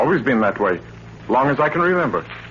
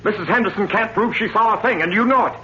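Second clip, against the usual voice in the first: indignant protest; 9 semitones higher than usual. Acted. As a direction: defend your wife, angrily cutting him off. Husband protests accusation against wife.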